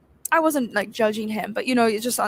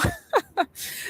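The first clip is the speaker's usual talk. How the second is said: high giggle; 14 semitones higher than usual. Real, not acted. Laughing at what she's watching.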